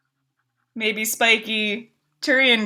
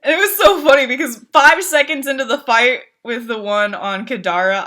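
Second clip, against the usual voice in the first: ordinary talk, nothing marked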